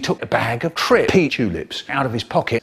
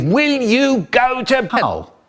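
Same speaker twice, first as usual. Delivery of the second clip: high, drawn-out rant; 9 semitones higher, slower than usual. Acted. Neighbour fed up with the behaviour, high drawn-out ranting. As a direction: rave at them slowly in a raised pitch. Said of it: "rant and rave".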